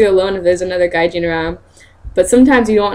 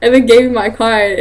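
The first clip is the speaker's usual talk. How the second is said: normal talking voice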